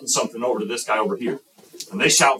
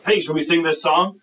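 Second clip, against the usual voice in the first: high, louder peppy pitch; eager suggestion; 7 semitones higher, 4 dB louder than usual. Acted. Leader pitching an idea to youth group, eagerly. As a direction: propose it with bouncy enthusiasm.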